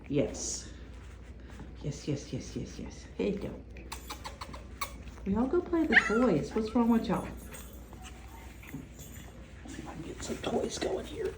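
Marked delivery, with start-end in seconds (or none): 0.1-3.5 soft breathy whisper to puppies. Real, not acted. Soothing puppies up close. 8.7-11.3 whispering. Real, not acted. Quietly coaxing puppies to toys.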